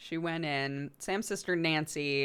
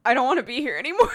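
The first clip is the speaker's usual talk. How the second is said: fast, louder and higher, rushing the words out; panicky whine; 6 semitones higher, 8 dB louder, much faster than usual. Retold or imitated. Daughter pleading with mom, a fast mock-panicked whine. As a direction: blurt it like a scared kid begging to leave. Nancy panicking about the hidden thing.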